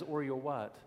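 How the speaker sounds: soft, low, unbothered; quietly serene, dismissing the threat; good witch calmly facing the wicked witch. Retold or imitated. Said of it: "she never loses a smile".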